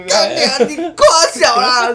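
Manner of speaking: high, loud tearful mock yell; angry yell with a crying voice. Drunk man cursing strangers, angry yell with a crying voice. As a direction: curse through half-laughing tears. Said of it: "还是带哭腔".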